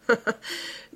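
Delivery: short mocking snigger; acted villain's chuckle; ricanement. A narrator's reading. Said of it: "Bridoux ricana". (petit rire narquois)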